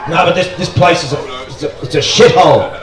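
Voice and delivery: posh English disdain with a laugh; posh English accent. Retold or imitated. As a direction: sniff at the place in posh English. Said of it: "He has that posh English accent".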